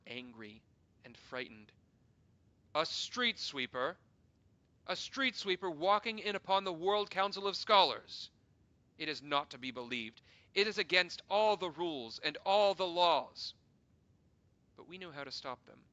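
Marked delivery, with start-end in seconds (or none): 2.8-13.5 loud, high, shocked outrage; outraged scholars. Acted. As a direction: cry it out in scandalised disbelief. Scholars angry and frightened.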